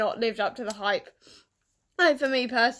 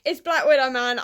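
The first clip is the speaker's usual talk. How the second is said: usual speaking voice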